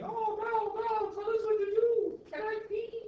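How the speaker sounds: very high, loud pleading to the leader; mock devotee. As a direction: beg like a meek follower. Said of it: "They were just saying".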